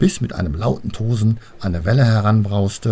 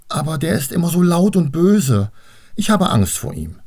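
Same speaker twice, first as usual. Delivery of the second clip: high-voiced, meek little girl; timid objection, pitched up at the start; 8 semitones higher than usual. A narrator's reading. Annabel admits her fear of Vater Rhein, meekly. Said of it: "sagte Annabel kleinlaut".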